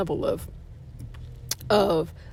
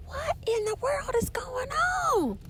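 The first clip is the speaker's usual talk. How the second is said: high, incredulous exclamation; mock astonished; 4 dB quieter than usual. Asking what in the world is going on, mock astonished. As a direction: gasp out the question in disbelief. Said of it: "I was like".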